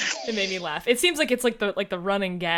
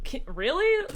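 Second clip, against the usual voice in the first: mock disbelief; 11 semitones higher, 5 dB louder than usual. Quoting the band's sarcastic reply.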